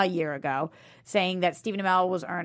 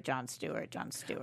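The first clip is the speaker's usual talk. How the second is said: softer each time, then breathless whisper; fading to a whisper; 8 semitones lower, 14 dB quieter than usual. Pretend. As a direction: let it die away to a whisper. Name repeated fading to whisper.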